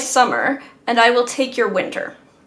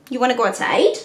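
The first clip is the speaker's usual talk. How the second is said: normal talking voice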